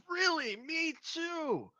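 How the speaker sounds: high, loud, excited tipsy dwarf; drunk dwarf, delighted. Acted. Drunk dwarf meets a fellow countryman, loudly delighted. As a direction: burst out high, then settle a little. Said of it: "the dwarf is pretty drunk".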